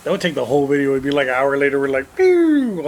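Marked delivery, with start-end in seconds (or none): 2.2-2.8 high, loud mock arrow whoosh; arrow shot noise. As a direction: fire off a long falling "pew". Imitating an arrow being shot.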